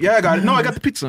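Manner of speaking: mock cocky pizza guy. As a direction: ham up the guy who claims he has it covered. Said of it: "that guy that wants to act like".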